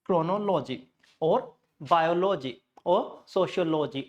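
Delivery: chronology, biology, sociology each split before "LO-jee"; full "logy" in chronology, biology, sociology; wrong -OLOGY endings. Shown as a demonstration. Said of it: "pronunciation are wrong".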